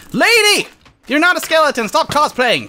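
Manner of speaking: high-pitched comic scolding yell; mock-outraged yelling. Yells at an enemy in game.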